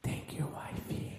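slow breathy seductive whisper; sultry whisper. Pretend. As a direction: draw it out in a sexy whisper. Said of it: "let me give you some sound effect".

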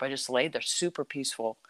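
usual speaking voice

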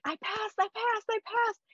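high-pitched disbelieving joy, retold; elated announcement. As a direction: squeal it like replaying good news. Retelling her own joyful announcement.

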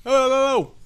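high, loud hype greeting; hype shout. Acted. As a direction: belt out the hello. Podcast intro hype.

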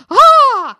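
high, very loud "Ah!" shriek; excited scream. Retold or imitated. Teller reenacting first chat room message, loud high scream. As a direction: reenact a startled shriek at full volume. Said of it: "we both went".

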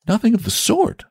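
put-on high, excited young boy; a cheeky lad teasing; eager boy voice. A narrator's reading.

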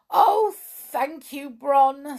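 high, loud, gushing thanks; drawn-out delighted gratitude; grateful. A narrator's reading. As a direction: thank him as little storybook brownies. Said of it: "said the grateful little brownies".

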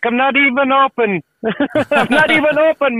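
high, laughing impression of the shop lady; mock vendor voice, laughing; mimicked lady. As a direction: squeak it out like the annoyed vendor, laughing. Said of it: "she's like".

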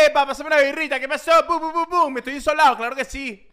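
high, excited beach party voice; party on a boat. Pretend.

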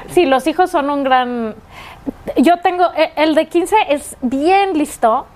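ordinary talk, nothing marked

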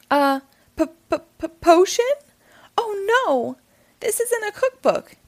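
high, stammering horror; horrified. A narrator's reading. Cat realizing the cookbook is a spell book, high and horrified. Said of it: "he realized with horror".

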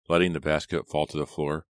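usual speaking voice

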